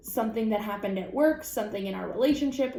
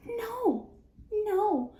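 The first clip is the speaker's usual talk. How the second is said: high squeaky no; 12 semitones higher than usual. Real, not acted. High mock refusal.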